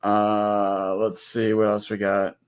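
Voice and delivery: usual speaking voice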